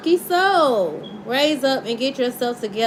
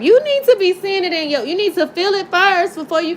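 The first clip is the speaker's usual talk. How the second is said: suddenly louder and higher, pushing the point; excited insistence; 6 semitones higher, 4 dB louder than usual. Real, not acted. Tarot reader pressing her viewers, excited. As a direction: urge the viewers with rising excitement.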